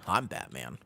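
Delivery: low, soft superhero growl attempt; Batman. Streamer imitating the superhero for chat, low and quiet. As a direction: drop it low like a masked vigilante. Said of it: "did I do it better now".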